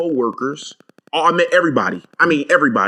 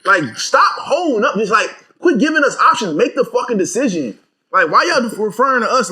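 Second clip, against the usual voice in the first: heated rant; 9 semitones higher than usual. Real, not acted.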